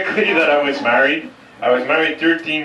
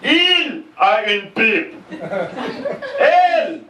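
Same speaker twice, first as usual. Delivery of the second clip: hot-tempered shout; 13 semitones higher, much slower than usual. Retold or imitated. Brother-in-law yelling at the staff for a straw, high angry shout. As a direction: bark it across the restaurant, stretching the end. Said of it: "has a famous Irish temper".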